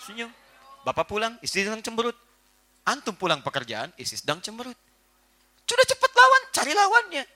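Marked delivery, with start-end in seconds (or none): 5.7-7.2 urgent eager push. Real, not acted. Preacher urges quickly.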